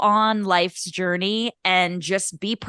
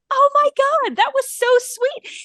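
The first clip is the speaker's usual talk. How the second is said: much higher, louder, gushing squeal; 4 dB louder than usual. Retold or imitated. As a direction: squeal like something is adorable. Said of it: "the more it was like".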